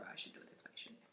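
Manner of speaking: barely audible whisper to oneself; very soft, voiceless aside. Real, not acted. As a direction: drop to a whisper, as if thinking aloud. Muttered aside while adjusting swatch.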